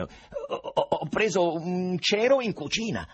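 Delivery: Ivan agitato. A narrator's reading. Ivan risponde al medico.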